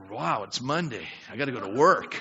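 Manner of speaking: low, deflated mock wow; Monday dread. Churchgoer getting out of bed on Monday, glumly. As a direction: drag it out like a reluctant riser. Mimics gloomy riser, contrasts joyful one.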